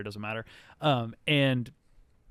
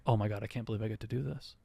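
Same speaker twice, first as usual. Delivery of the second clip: fast, soft, lower-pitched muttering to oneself; hushed aside; 4 semitones lower, 8 dB quieter, much faster than usual. Acted. Fenn to herself before playing parent, hushed aside. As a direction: say it quickly under your breath.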